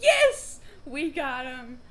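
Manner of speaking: high, loud triumphant yell; excited cheer. Real, not acted. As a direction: celebrate the win. Celebrating the finished intro.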